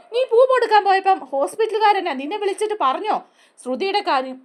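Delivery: high, loud cutting interruption; angry retort. Retold or imitated. Chandra cutting off Revathi's excuse, angry and loud. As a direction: snap back in disbelief.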